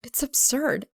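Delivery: plain reading voice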